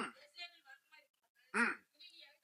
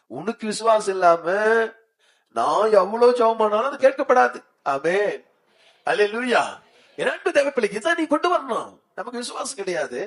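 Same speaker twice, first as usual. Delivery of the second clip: impassioned preaching; 8 semitones higher than usual. Real, not acted. Preacher warning that faithless prayer goes unheard, impassioned. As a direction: pitch climbing into high exhortation.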